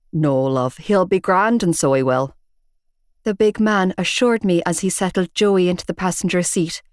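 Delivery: a hint of a gruff Belfast man; Belfast accent. Big bearded man telling off Shane, in a Belfast accent. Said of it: "distinctive Belfast accent".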